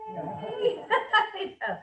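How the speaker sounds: laughing while talking. Real, not acted. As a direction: talk through a laugh. Laughing at no cleaning.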